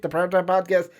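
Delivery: normal talking voice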